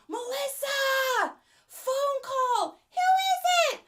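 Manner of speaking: high, shouted call up the stairs; yelling through the house. Acted. Mom shouting to Melissa that she has a phone call. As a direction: holler for her across the house.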